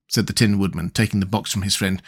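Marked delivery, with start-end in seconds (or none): none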